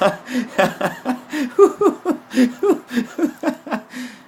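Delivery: high, loud shouting laugh; real laughter bubbling up; burst of laughter. Real, not acted. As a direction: let the held-in laugh explode. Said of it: "That was repressed laughter".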